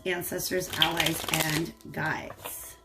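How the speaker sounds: low, soft, murmured thanks to spirits; hushed, low-pitched prayer; prayerful invocation. Real, not acted. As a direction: recite a quick invocation under your breath, slowing at the end. Invokes spirits before shuffling.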